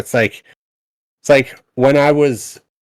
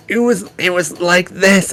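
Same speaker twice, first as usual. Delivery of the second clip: put-on old nasally voice; 5 semitones higher than usual. Shown as a demonstration. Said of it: "tried to do a bit of a nasally vo".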